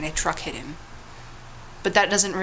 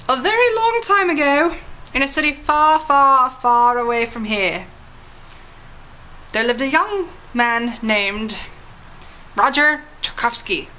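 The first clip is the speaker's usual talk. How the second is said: storybook opening; 4 semitones higher than usual. Acted. Starts fairy-tale storytelling voice.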